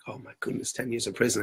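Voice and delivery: soft, low, stunned murmur; hushed shock as you take it in; under your breath, dismayed. Retold or imitated. Retold defendant stunned by sentence.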